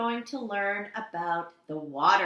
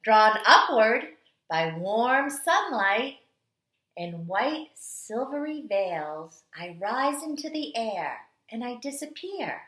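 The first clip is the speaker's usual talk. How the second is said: storytelling read-aloud; 3 dB louder than usual. Acted. Teacher reading The Water Dance to kindergarteners, dramatic storytelling. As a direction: read it like the water itself is dancing. Reads picture book aloud.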